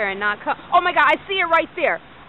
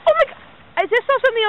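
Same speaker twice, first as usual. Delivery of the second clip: startled excitement; 10 semitones higher, 5 dB louder, much faster than usual. Real, not acted. Spots something blinking in sky.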